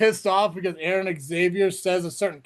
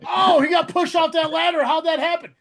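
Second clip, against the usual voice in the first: higher, louder dumb question; mock clueless fan; 7 semitones higher, 5 dB louder than usual. Host mocking fans, higher and louder. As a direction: play the baffled fan.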